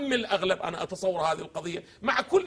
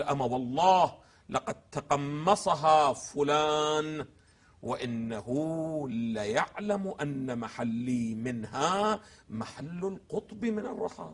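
very slow, low quoted sermon; slow recitation; 6 semitones lower, much slower than usual. Acted. Lecturer reading the third sermon aloud, very slow recitation. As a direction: let each phrase of the sermon land slowly.

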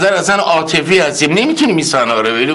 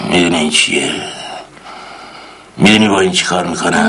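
low, slightly quicker threat; menacing interrogator; 7 semitones lower, faster than usual. Acted. Uncle threatens with the next tool, low menace. Same line repeated.

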